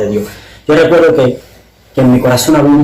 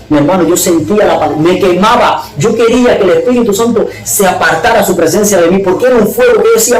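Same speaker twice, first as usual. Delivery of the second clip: high-pitched, urgent telling; impassioned testimony; 7 semitones higher than usual. Real, not acted. Testifying to his brother about the burning presence, impassioned and high. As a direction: tell the climax with pitch climbing steeply.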